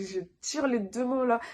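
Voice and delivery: ordinary talk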